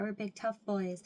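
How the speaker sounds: ordinary talk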